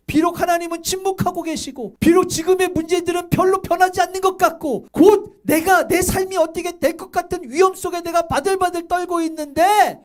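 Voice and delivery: fervent exhortation; impassioned preaching. Real, not acted. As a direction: preach it high and insistent to the congregation. Builds a desperate sermon passage.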